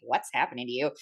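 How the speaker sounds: a low, puzzled husband; husband's baffled voice. Retold or imitated. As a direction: drop low as the confused husband.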